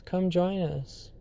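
very soft, slow, beckoning call; a ghostly invitation from the tunnel; eerie sewer voice. Retold or imitated. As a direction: draw them in with a near-hush. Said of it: "the voice says".

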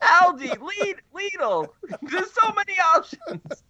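squeaky laughing voice; high mock plea through laughter; high joking plea. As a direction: keep the silly pleading voice going. Joking pleading voice continues.

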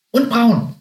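high little animal voice; chiming-in guinea pig. A narrator's reading. Guinea pig adds to the description, chiming in. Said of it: "ergänzte Meerschweinchen Hamlet".

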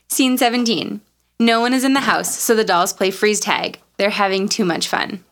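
ordinary delivery

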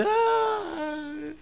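long, high, soft wail; mock crying wail. Groom weeping at having to renounce others, mock wail. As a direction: fake a drawn-out tearful "ay". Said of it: "Y llorando dice".

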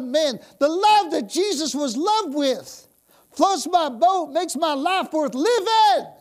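high, loud, fervent preaching; full-force preaching. Acted. Preacher rousing the church, full-force fervent preaching. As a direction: preach it with fire.

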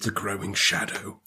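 hushed, ominous repeat; spooky hushed echo. Pretend. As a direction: murmur it like a creepy omen. Echoing the ominous phrase.

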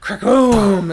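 booming thunder crack, shouted; a sudden loud crack of thunder; thunder crack sound. Acted. Thunder burst covering hammering.

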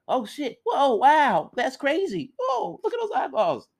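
very high astonished whoops; amazed crowd. Acted. Villagers gape at the hanging eyeballs, astonished. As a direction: gasp out wonder at the trick.

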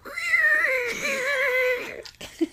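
high squealing bellow like a pig in a vacuum hose; moose bellow. Acted. Asked to redo the moose bellow, high squeal. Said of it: "That was perfect".

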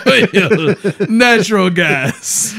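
put-on slow, laughing Russian accent; drawn-out mock Russian; fake Russian accent. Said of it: "I only speak Russian now".

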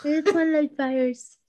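put-on high, giddy little-kid voice; squeaky pyromaniac kid; childish fire lover. Teasing, playing a fire lover.

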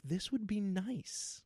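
soft, slow dreamy musing; wistful thought. Retold or imitated. Dad imagining a kid, soft slow wistful musing. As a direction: savour the idea softly and slowly.

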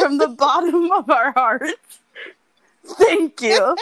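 over-the-top gratitude, half laughing; grand mock thanks. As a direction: proclaim it like a tribute speech, laughing. Mock solemn thanks to heroines.

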